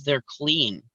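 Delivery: low, flat-voiced "They're clean." dropped softly; grumpy retort. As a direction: mutter "They're clean." like a curt clerk. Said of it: "totally grump mode".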